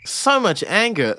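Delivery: high, drawn-out mock disbelief; mocking echo. Mockingly echoes the clip just played.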